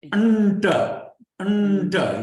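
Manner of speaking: Anta, anta called out loud, each ending on a fall; loud drilled demo. Shown as a demonstration. Teacher drilling students in Sanskrit sounds, loud and emphatic. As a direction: stamp out "Anta" twice for the class. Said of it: "the an must blend into the ta".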